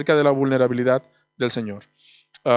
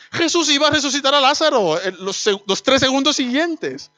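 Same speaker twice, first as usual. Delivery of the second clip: rising fervour; 10 semitones higher than usual. Real, not acted. Climax of sermon point.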